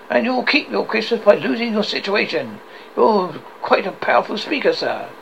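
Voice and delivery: warn, then turn a touch sarcastic; irritable Scrooge. Acted. Scrooge threatens the clerk and mocks his nephew, mildly irritable.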